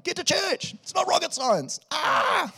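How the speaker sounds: high, loud shouting cry; exasperated yell. Retold or imitated. The author of Hebrews yelling at readers, high and exasperated. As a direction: yell it, fed up and pleading. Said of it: "I just hear the author going".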